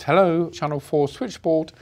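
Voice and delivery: a light London estuary lilt on the greeting; sing-song London switchboard operator, lightly done; London estuary accent. Retold or imitated. Switchboard operator answering a hostage's call, light London estuary accent. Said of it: "that beautiful estuary voice, that London voice".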